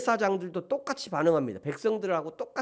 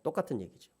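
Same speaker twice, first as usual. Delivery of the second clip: normal talking voice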